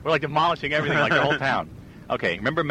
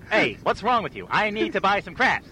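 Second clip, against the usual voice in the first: a higher, lightly voiced game show host; Regis Philbin; 5 semitones higher than usual. A hint of Regis Philbin shopping for crafts at a store. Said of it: "I'm gonna be Regis Philbin".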